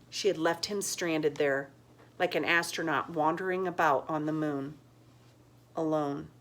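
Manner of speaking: plain reading voice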